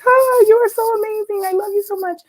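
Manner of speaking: very high-pitched mock adoration; cartoonish gushing. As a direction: imitate a man fawning in a squeaky cartoon voice. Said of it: "if this were a cartoon".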